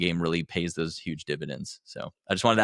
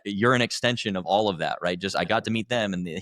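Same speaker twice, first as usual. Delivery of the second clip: ordinary talk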